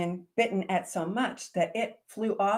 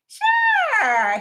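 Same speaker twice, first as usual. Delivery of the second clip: much higher loud shout; shouted sure; 11 dB louder than usual. Acted. Baby dragon reassuring siblings, shouted. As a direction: blurt it out loud.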